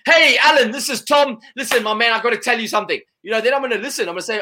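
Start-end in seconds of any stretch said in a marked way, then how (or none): 0.1-3.0 high, loud, upbeat mock sales opener; energetic cold caller. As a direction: grab the listener like a keen salesman. Imitating an engaging, energetic cold caller.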